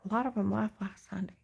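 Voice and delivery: quick unvoiced whisper, as if sharing a secret. Real, not acted. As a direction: hush it fast, breath only. Secret aside mid retelling.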